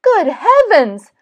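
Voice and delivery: high, loud gasp of astonishment in words; startled storybook character; astonished Frog. Acted. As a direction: exclaim in shock. Frog shocked on recognising Toad.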